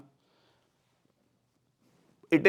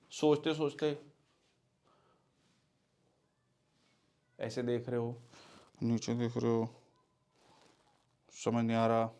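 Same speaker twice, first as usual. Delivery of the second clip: unsure mumble; 3 semitones lower, 7 dB quieter than usual. Shown as a demonstration. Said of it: "आपका lack of confidence show करता है".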